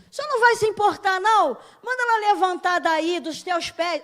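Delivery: high-pitched indignant Martha; Martha demanding. Retold or imitated. Martha loudly telling Jesus to send her sister. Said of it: "Fala coisas que não faz sentido".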